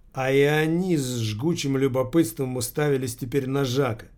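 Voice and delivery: plain reading voice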